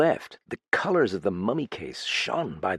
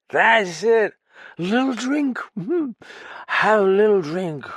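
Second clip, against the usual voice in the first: put-on persuasive drunk; 12 semitones higher, 4 dB louder than usual. Drunk coaxing his guest to stay, high and slurred. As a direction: wheedle him into staying.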